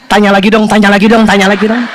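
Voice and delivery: low, repeated inner-voice plea; inner pleading. Retold or imitated. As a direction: chant it low, like a secret wish. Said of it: "Padahal dalam hatinya".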